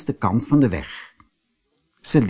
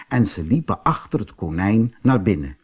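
ordinary delivery

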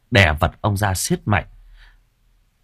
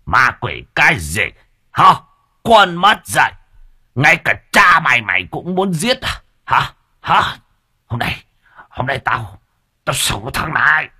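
higher, louder furious father snapping; father spitting out angry threats; hissing with rage; 6 semitones higher, 3 dB louder than usual. A narrator's reading. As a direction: rage against the ungrateful son. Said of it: "như rít lên".

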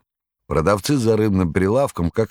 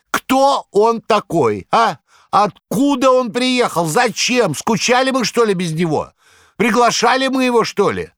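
mocking tirade; 5 dB louder than usual. A narrator's reading. Korovyev jeering at the fat foreigner, loud high scorn. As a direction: stir the crowd against him.

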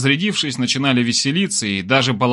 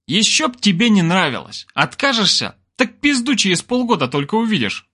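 high, needling grumble; grumbling; 8 semitones higher than usual. A narrator's reading. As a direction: jeer crossly at a raised pitch. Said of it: "буркнул Олег".

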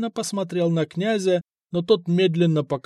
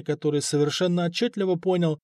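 ordinary delivery, nothing marked

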